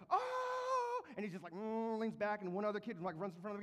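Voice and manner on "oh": very high, loud mock "Oh!"; crowd gasp. Kids at the rap battle cheering a burn, high loud whoop.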